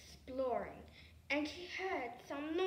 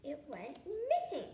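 ordinary delivery

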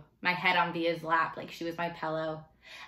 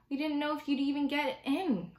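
put-on much higher-pitched boy's reply; child's voice; 9 semitones higher than usual. A narrator's reading. Boy in talk about getting into school, child's voice. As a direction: answer high, in the young boy's voice. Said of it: "Augie answered".

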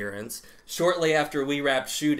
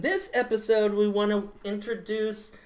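cutesy pet voice; 6 semitones higher, 3 dB louder than usual. Real, not acted. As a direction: coo it like talking to a kitten. Introducing the kitty mascot.

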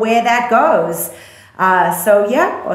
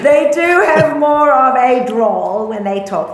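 put-on Australian accent; 4 semitones higher than usual. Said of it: "okay, there I go".

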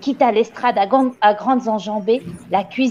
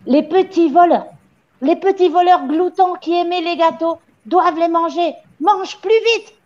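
raised-pitch menacing rant; angry tirade; 7 semitones higher than usual. A narrator's reading. Le Gourdin threatening the petit voleur with l'étouffoir, angry rant. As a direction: berate and threaten, pressing hard. Headmistress ranting threats at boy.

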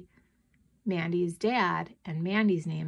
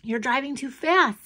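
complaining; 5 semitones higher, 3 dB louder than usual. A narrator's reading. Daughter criticising father's driving.

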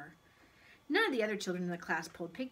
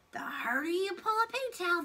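high, louder coaxing creature; squeaky, eager persuasion; persuasive pigtail; 10 semitones higher, 5 dB louder than usual. A narrator's reading. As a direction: egg him on in a little voice. Pigtail coaxes Paul.

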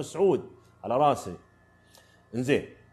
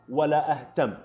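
ordinary delivery

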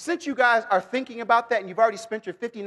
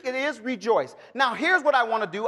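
ordinary talk, nothing marked

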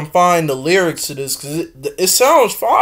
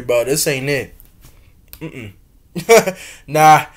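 normal talking voice

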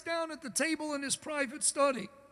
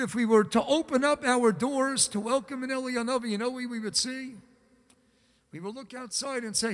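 ordinary talk

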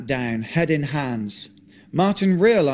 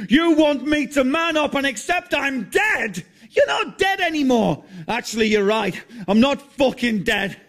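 high, raised angry exchange; heated shouting; 12 semitones higher than usual. Acted. John arguing with Martin about being dead, heated shouting. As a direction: keep the rage up. Quarrel continues.

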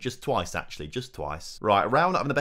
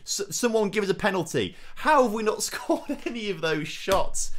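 much higher, disbelieving plea with a laugh; frustrated plea; 9 semitones higher, 3 dB louder than usual. Real, not acted. Manager watching shots go wide, high frustrated plea. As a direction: beg the referee, then laugh in disbelief.

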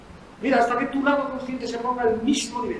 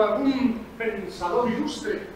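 ordinary talk

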